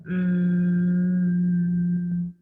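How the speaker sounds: slow, long buzzing hum; bee voice. Shown as a demonstration. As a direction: hum it out like a bumblebee. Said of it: "you essentially sound like a bumblebee".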